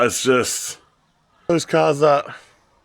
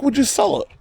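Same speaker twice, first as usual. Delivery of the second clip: hopeful plea; 13 semitones higher than usual. Retold or imitated. Asking buyer to sell.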